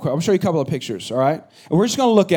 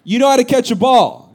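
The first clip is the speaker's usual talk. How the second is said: sharp raised scold, higher and louder; scolding son; 5 semitones higher, 4 dB louder than usual. Retold or imitated. Dad scolding his son at catch, raised voice. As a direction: snap at the kid who won't catch. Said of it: "I said".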